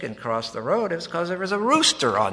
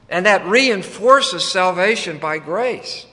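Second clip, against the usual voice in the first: higher, louder sermon climax; preaching at full force; 5 semitones higher, 7 dB louder than usual. Acted. Preacher urging the congregation not to miss it, fervent. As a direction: drive the message home from the pulpit.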